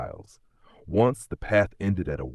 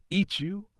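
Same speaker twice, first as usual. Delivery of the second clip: much higher startled echo; surprised; 14 semitones higher, 4 dB quieter than usual. A narrator's reading. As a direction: caught off guard, squeak it. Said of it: "The lion looked surprised".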